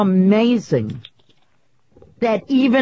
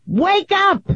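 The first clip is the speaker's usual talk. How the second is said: high yell at the Academy; mock wake-up call; 9 semitones higher than usual. Yelling at the Academy.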